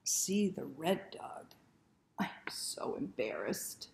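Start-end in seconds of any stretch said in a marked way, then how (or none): 2.2-3.9 low, shy whisper; embarrassed whisper. Acted. The red-faced dog, whispering in shame. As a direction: whisper it, blushing.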